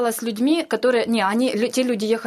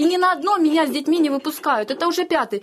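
higher, urgent pleading at the border guards; retelling a desperate plea, voice raised high; 5 semitones higher than usual. Retold or imitated. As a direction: plead that you will not leave. Woman pleading at border checkpoint.

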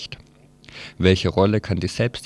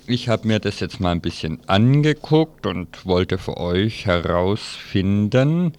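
normal talking voice

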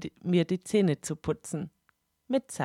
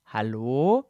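Regisseur; 7 semitones lower than usual. Acted. Regisseur klopft nochmal.

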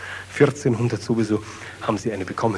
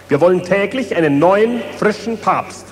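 higher, louder gleeful demand; excited demand; 6 semitones higher, 4 dB louder than usual. Acted. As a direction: demand it like a giddy crowd. Crowd demanding popes.

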